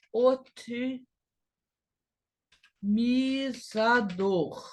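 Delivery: "otimizador" with long pauses between parts; slow, broken into syllables. Shown as a demonstration. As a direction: dictate "otimizador" piece by piece, very slowly. Teacher says word while writing.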